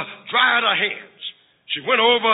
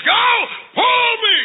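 high, loud demand as the bossy sister; 11 semitones higher, 3 dB louder, slower than usual. Acted. Sister ordering brother to pull the wagon, bossy. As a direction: order him in a high, commanding voice.

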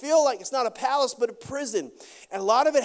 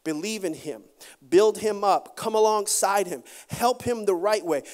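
normal talking voice

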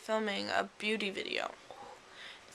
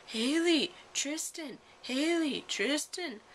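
imitating a neighbor banging on the door; retold yelling; 6 semitones higher, slower than usual. Neighbor shouting names through the glass door, mock yell. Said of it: "she was yelling".

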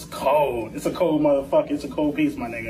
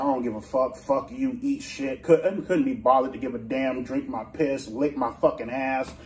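low insult rant; 6 semitones lower than usual. Acted.

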